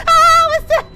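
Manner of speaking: high, loud shriek of fright; frightened scream. Real, not acted. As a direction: scream it in real panic. Startled by noise in game.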